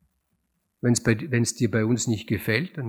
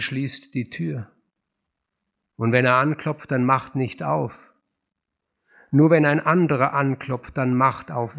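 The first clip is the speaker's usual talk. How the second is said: commanding; 7 dB louder than usual. A narrator's reading. Goneril giving harsh orders.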